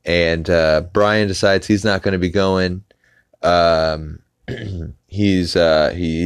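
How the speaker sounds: ordinary talk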